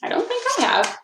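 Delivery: put-on high childlike reply; Lucy's voice. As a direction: answer shyly like a little girl. Lucy answers grandpa.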